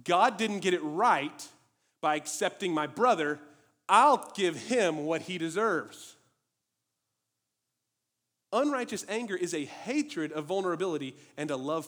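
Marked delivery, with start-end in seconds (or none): 0.0-6.2 slow, loud bitter grumble; Cain's resentment. Retold or imitated. Cain resenting God, slow loud bitter grumble. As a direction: spit out the grudge slowly. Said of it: "That's what Cain was doing".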